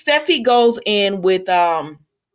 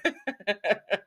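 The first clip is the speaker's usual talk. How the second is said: softer trailing laughter; real laugh; 8 dB quieter than usual. Real, not acted. Host amused by her own impression, real laugh. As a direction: laugh it out softly.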